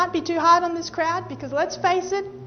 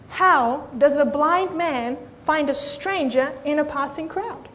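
normal talking voice

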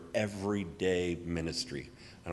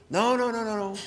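frantic refusal; 12 semitones higher than usual. Retold or imitated. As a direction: wave him off in a squeaky rush. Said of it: "man refusing literature again".

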